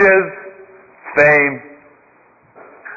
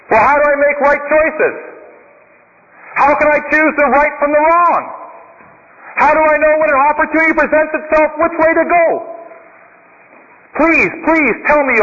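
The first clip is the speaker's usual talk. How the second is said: put-on higher, pleading young man; eager young seeker; 6 semitones higher than usual. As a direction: beg the monk for the answer. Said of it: "the man says".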